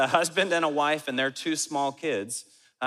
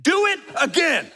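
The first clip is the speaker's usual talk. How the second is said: very high, loud challenge; booming mock tough-guy threat; Macho Man Randy Savage; 13 semitones higher, 7 dB louder than usual. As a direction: shout a wrestler-style dare, hammed up. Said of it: "Macho Man Randy Savage style".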